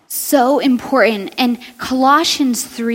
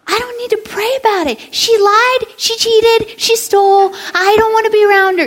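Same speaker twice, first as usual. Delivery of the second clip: put-on indignant kid; 9 semitones higher than usual. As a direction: protest like a stubborn teenager. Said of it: "And I would be like".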